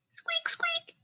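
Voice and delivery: high, whispered mouse squeaks; hushed high mouse squeak; squeaky mouse. Acted. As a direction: squeak it tiny like a little mouse. Mouse voice in picture book.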